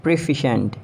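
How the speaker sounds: "proficient" as pruh-FISH-unt; three syllables in "proficient"; British pronunciation. Shown as a demonstration. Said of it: "It's proficient".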